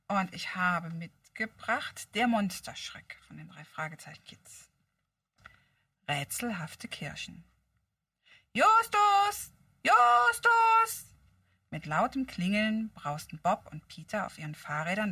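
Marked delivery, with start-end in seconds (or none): 3.3-4.7 whispered title. Real, not acted. She presents the book to listeners in a whisper. 8.5-11.0 loud high call. Acted. Bob calls Justus across the junkyard, loud and high. Friends calling out.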